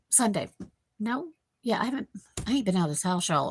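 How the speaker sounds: usual speaking voice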